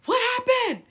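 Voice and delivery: high, loud startled dad; dad impersonation. Retold or imitated. Dad hearing a thud upstairs, high loud call. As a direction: shout up the stairs like her dad. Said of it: "really good impersonation of him".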